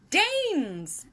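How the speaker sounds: high, loud triumphant shout; triumphant cry. Acted. Giant answering Sophie's riddle, triumphant high cry. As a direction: crow it like you've won. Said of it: "the giant cried triumphantly".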